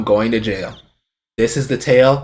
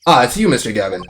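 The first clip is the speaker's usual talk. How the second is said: normal talking voice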